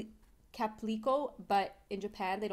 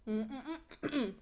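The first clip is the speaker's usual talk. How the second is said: chewing while speaking; humming with closed mouth; mouth full of jelly; slower than usual. Real, not acted. Seen 1: Left speaker holds jelly cup, lips closed, chewing while humming.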